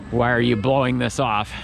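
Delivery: ordinary talk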